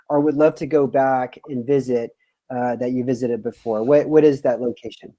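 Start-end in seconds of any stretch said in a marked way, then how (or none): none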